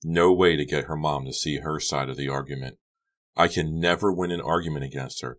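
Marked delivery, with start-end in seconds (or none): none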